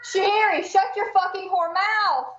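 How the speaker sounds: high, loud real scolding yell; yelling at cat. Real, not acted. Streamer thinks mic is muted, yelling at the cat. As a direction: yell sharply at the misbehaving pet. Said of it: "to yell at my cat".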